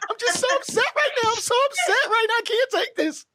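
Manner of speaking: high whiny mock sobbing voice; mock crying. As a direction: whine it out, pretending to be upset. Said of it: "You start crying".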